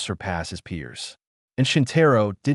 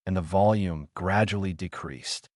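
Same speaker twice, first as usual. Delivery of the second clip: ordinary delivery, nothing marked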